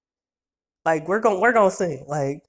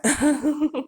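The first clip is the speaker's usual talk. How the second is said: real high chuckle; short, high amused giggle; 8 semitones higher than usual. Real, not acted. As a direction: laugh at your own joke. Laughs at own Jesus joke.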